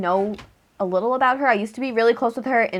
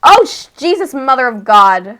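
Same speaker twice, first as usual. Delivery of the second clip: startled outburst; 6 dB louder than usual. Real, not acted. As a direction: caught off guard, blurting out. Sudden real startled outburst.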